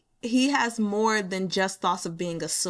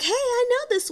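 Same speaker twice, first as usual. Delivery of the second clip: high, eager "I know this one"; light, excited pop of recognition; mock excited recognition; 4 dB louder than usual. Reader recalling spotting the expression, high and eager. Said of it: "I was like".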